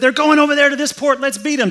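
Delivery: rally the others to race ahead; eager crowd. Acted. Crowd racing to the boat.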